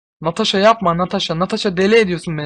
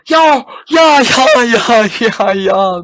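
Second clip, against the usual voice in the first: much higher, louder "ya ya"; triumphant shout; 6 semitones higher, 10 dB louder, much slower than usual. Real, not acted. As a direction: roar of victory. Cheering lucky win. (zafer çığlığı)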